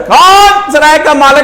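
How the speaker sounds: loud, high, slow cry; fakir's call. Retold or imitated. Fakir asking for the inn's owner, loud drawn-out call. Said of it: "فقیر کی آواز آئی".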